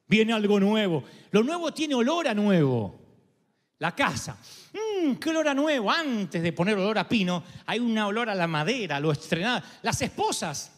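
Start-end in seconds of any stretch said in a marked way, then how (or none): 4.7-5.9 high appreciative hum into the words; savouring. Acted. Preacher acting out entering a new house, savouring. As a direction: breathe in the new smell with relish.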